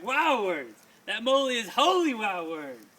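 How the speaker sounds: big loud exclaimed wow. Acted. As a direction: shout the wow to the room. Poem climax, exclamations.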